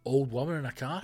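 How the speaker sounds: ordinary talk, nothing marked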